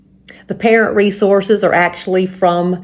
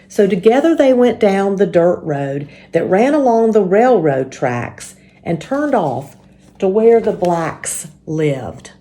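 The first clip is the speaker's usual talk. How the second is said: ordinary delivery, nothing marked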